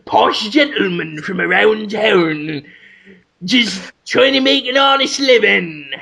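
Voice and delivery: wandering put-on gentleman's accent; hammed-up British accent sliding between posh and street; changing accent. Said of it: "my accent keeps changing".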